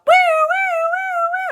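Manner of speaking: very high, loud siren imitation; siren wail. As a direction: wail like an alarm. Alarms went off.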